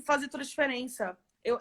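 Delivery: ordinary delivery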